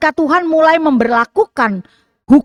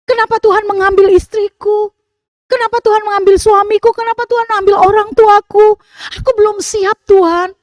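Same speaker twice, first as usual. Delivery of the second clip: higher, louder wailing plea to God; acted lament; 8 semitones higher, 3 dB louder than usual. Preacher acting a mourner's wailing prayer to God, higher and louder. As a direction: cry out to God like a grieving widow. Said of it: "kita meratap kepada Tuhan".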